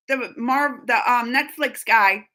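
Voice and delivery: ordinary talk, nothing marked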